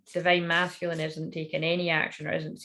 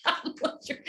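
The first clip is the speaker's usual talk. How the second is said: giggling; 5 dB quieter than usual. Real, not acted. Giggle after the song.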